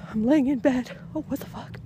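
usual speaking voice

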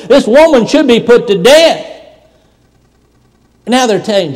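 loud, high, ringing preacher's voice; a revival preacher lifting the room with every line; full-force preaching. Acted. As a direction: preach it out hard to the pews. Preaching to congregation.

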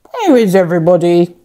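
quick, gruff pirate captain voice; growled. Acted. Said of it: "growled the pirate captain".

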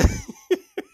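high-pitched burst of laughing; real laughter. Real, not acted. Host cracking up after telling him to stand up like a man, laughing. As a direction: crack up in high, helpless giggles.

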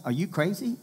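high, disbelieving modern-day retort; incredulous disbelief. Retold or imitated. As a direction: throw it back like the crowd is obvious. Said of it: "in modern day language".

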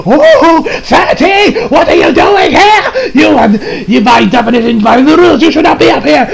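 high, slow-drawn hammed-up indignation; over-the-top imitation of an outraged official; scandalized villain scolding. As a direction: scold an intruder in a squealing cartoon voice. Acted quote, villain scolding an intruder.

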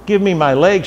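a restrained narrator's version of a scream; mock scream. A narrator's reading. Woman demands her wooden leg back, mildly screaming. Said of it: "she screamed and tried to lunge for it".

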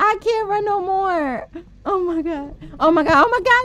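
loud panicked whine; crying out in panic. Real, not acted. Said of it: "I'm out of breath".

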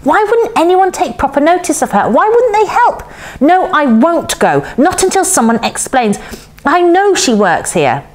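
put-on high, indignant girl; frustrated high-voiced insistence; rising anger. A narrator's reading. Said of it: "feeling the anger rise".

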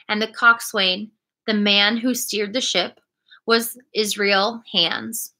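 plain reading voice

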